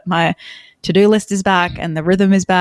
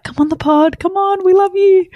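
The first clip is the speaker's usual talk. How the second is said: high, eager mock email plea; gushing invitation; 12 semitones higher than usual. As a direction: sweetly beg her to come on the pod. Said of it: "email Patricia and be like".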